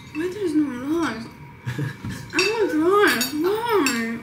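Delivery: mock whining. Acting out her imagined reaction to him, mock whining. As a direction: whine like a sulky kid who wants rice.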